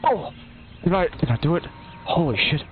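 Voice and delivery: excited disbelief. Real, not acted. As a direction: gasp with delighted disbelief. Surprised by his own success.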